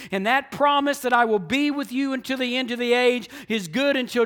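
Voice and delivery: loud, high, driving sermon delivery; full-force preaching. Real, not acted. Preacher urging the church to go and tell, full-force preaching. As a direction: preach it out with punched key words.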